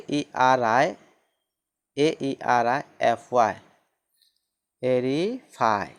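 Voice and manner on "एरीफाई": एरीफाई said slowly as e-ri-fai; slow model word. Shown as a demonstration. Teacher models एरीफाई slowly for students. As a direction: draw out एरीफाई syllable by syllable. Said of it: "इसका उच्चारण क्या हो जाएगा".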